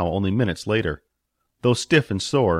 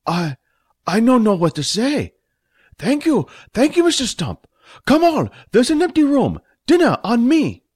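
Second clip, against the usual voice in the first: much higher, louder put-on voice, gushing thanks; stunned grateful Fat; 14 semitones higher, 5 dB louder than usual. Owner thanking Stump for the deed, high gushing voice. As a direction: gush with shaky delight, high and loud.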